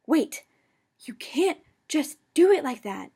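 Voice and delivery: loud, out of breath; panting shout. A narrator's reading. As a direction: shout while struggling. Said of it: "Remus panted".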